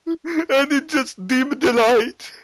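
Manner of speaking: high, slow hammed-up whine; mock Boris lament. Boris telling the TV host, high and whining. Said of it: "Boris on TV".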